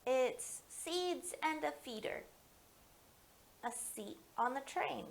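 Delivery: gentle picture-book read-aloud; story reading to children. Acted. Librarian reading Finding Kindness aloud at story time.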